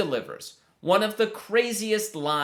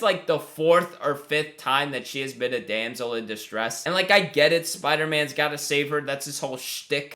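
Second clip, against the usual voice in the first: ordinary delivery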